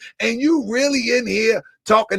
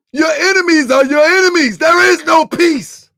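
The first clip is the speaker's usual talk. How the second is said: louder, higher, slowing on no peace; loud rebuttal; 6 semitones higher, 6 dB louder than usual. Real, not acted. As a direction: hammer it home against the preacher. Angry rebuttal of the preacher.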